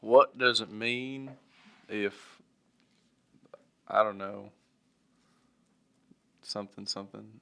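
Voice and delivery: slow, formal, pitch up letter voice; mock letter to Google. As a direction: read it like a quaint old letter. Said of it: "They're like".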